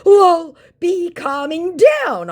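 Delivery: high, very slow sneering put-on voice; mocking the scammer's broken English. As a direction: drawl it out like a smug scammer. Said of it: "my scammer said".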